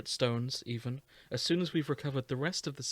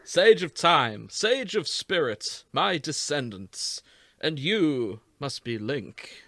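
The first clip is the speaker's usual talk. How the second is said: Matt Berry voice; 6 dB louder than usual. Acted. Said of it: "you're doing the Matt Berry for this one".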